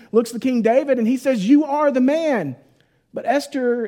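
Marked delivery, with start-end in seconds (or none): 1.5-2.6 acted accusation, higher in pitch; pointed, raised-pitch charge of guilt; confronting. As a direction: play a prophet confronting a king. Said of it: "in this moment of confrontation".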